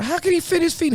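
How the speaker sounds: high-pitched, incredulous retort; incredulous question. Retold or imitated. Friend questioning cellmate about the shoes, incredulous. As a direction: ask it in high disbelief.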